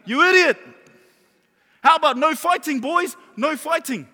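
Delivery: high, loud retold scolding; exasperated mock yell. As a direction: re-enact exasperation at full volume. Acts out his exasperated reaction.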